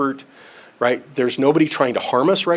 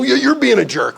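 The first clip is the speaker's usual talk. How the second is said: high, loud hammed-up yell; exaggerated shouted counter-accusation; mock furious outburst; 12 semitones higher, 7 dB louder than usual. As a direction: yell it back like a table-flipping row. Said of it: "and I throw the table".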